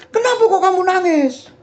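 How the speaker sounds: high-pitched mock query to a child; imitated questioning parent. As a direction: ask it high, like a parent to a crying toddler. Father questioning crying child, retold.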